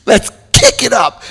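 high, peppy mock eagerness; light hammed-up enthusiasm; eager drinker. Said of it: "we're like".

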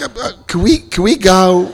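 hesitant, begging to leave; meek visitors. Retold or imitated. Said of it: "they're like".

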